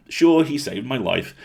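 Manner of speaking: ordinary delivery, nothing marked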